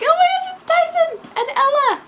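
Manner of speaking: very high, loud fangirl squeal; excited squeal. Retold or imitated. Reader reliving the moment she spotted Tyson and Ella, excited squeal. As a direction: squeal it in delighted recognition. Re-enacts joyful realization while reading.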